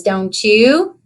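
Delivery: usual speaking voice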